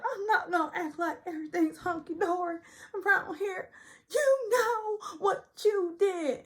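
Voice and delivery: high, teary, slowed wail; high weepy imitation, slowing at the end; mock crying Sutton. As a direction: drag it out through mock sobs. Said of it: "she's like".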